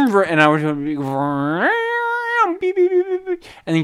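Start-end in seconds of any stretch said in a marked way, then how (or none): 1.0-3.4 engine rev into high rapid beeps; car noise. Shown as a demonstration. Sorority girl showing the car game, engine and horn imitation. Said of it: "your best car noise".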